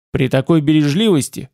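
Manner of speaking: usual speaking voice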